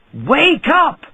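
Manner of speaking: high, loud, rising bark; angry shout. A narrator's reading. Rich man shouting angrily at the dwarf by his door. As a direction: yell at the sleeper on your doorstep. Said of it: "he shouted angrily".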